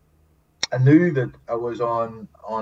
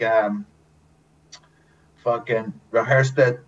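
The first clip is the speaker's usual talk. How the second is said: ordinary delivery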